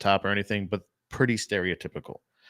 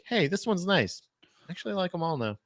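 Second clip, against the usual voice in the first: mock niceness; 7 semitones higher, 6 dB quieter than usual. Acting sudden change of heart.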